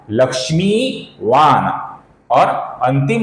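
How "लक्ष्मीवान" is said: लक्ष्मीवान with long mee, closing on a bare n; half final N. Shown as a demonstration. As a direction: stretch लक्ष्मीवान and stop on the n without a vowel. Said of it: "इसका सही उच्चारण है".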